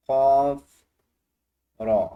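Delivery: क़ाफ़ रॉ called out loud. Shown as a demonstration. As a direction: belt the letters out for the class. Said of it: "तो क्या बना?". (ज़ोर से क़ाफ़ रॉ)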